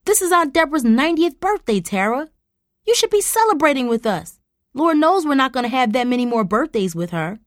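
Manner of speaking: high, loud reproachful insistence. Acted. Mom reproaching Tara about Aunt Deborah's birthday, high and loud. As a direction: lay on the guilt, loud and high. Mother pleads angrily.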